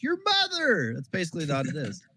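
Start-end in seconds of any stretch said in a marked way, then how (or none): 0.0-0.9 high, drawn-out mock gamer yell; imitated lobby scream. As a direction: holler it like trash talk in a game lobby. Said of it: "People just screaming at each other".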